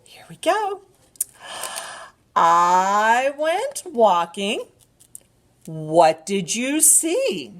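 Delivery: slow, animated picture-book reading; drawn-out storytime voice with gasps; reading to a toddler. Acted.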